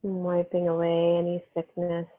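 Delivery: calm, unhurried meditation voice with long pauses; guided meditation. Real, not acted. Yoga teacher easing students into breath and healing energy, guided meditation. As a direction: settle them gently into the breath, phrase by phrase.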